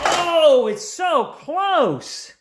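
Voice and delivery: high, drawn-out excited cry; thrilled at a neck-and-neck finish. Real, not acted. Close race finish.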